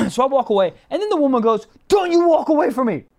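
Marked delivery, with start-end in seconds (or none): 1.9-3.0 imitating her high, indignant yell; mock furious call after someone. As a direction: yell it high at their back. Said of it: "she just wants to yell".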